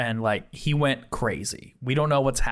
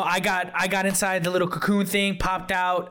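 usual speaking voice

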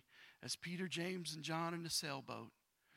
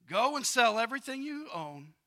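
loud, higher misquote of the line; wrong version, too loud; 6 semitones higher, 11 dB louder than usual. Shown as a demonstration. Preacher booms out the misquote to the congregation, loud. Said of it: "Nope. That's not what Jesus said".

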